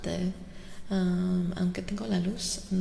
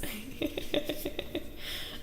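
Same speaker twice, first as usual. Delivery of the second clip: high, breathy giggle; airy, voiceless run of laughter; 6 semitones higher, 7 dB quieter than usual. Real, not acted. Streamer joking about an existential crisis, breathy giggle.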